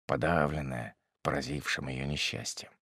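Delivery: ordinary delivery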